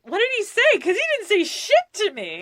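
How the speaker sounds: high, loud mock indignation; indignant disbelief. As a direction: retell it as an outraged, laughing demand. Retold indignant reaction to friend.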